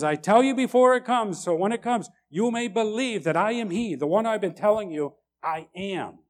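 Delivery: Jesus declares himself to the eleven, lifted and loud; proclaiming as Jesus. Retold or imitated. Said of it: "He says".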